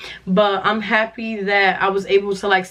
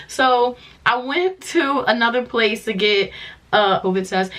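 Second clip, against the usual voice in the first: ordinary talk, nothing marked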